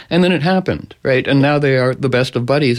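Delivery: usual speaking voice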